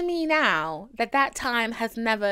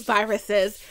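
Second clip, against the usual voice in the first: ordinary talk, nothing marked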